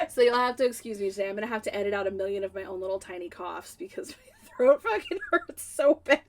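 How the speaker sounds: ordinary delivery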